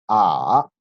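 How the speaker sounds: "A" dipping low, then rising; loud, drawn-out dipping "A"; third tone. Shown as a demonstration. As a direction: drop "A" down deep and bring it back up. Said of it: "baja un poquito y después vuelve a subir".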